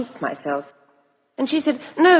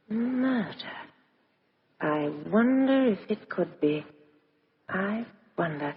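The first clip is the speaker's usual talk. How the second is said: much softer, slow, turning the word over; hushed musing; 2 semitones lower, 10 dB quieter, slower than usual. Acted. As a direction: think aloud almost to herself. Mulling over murder to herself.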